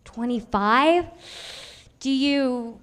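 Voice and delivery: put-on slow, drawn-out childish amazement; amazed kid. Little kid asking an adult, slow amazed. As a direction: gasp at how old that sounds.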